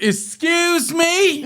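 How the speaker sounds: very high, slow, drawn-out indignant question; wife overhearing the son curse; shocked mom. Retold or imitated. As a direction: stretch it out like a scandalized mother. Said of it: "And she's like".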